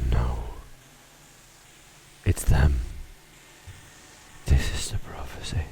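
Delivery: hushed, halting voice of foreboding; mysterious mental voice. Acted. Unknown presence realises the prophecy is here, hushed foreboding voice. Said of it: "you hear a voice going".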